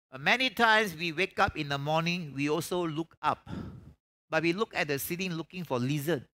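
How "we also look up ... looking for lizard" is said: ordinary talk, nothing marked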